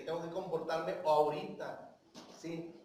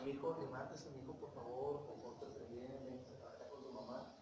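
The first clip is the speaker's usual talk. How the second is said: soft, much quieter coaxing parent; hushed, patient parent voice; very diplomatic; 6 semitones lower, 12 dB quieter than usual. Retold or imitated. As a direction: gently coax your own child off the floor. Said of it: "bien diplomático".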